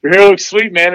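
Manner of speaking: put-on butter up the cocky kid, tongue in cheek; mock praise.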